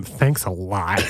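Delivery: low, slow, deadpan sarcasm; sarcastic thanks. Pretend. Closing a toast to Mike, sarcastic. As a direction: drag out the thanks, dropping low. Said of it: "I don't mean that sarcasm".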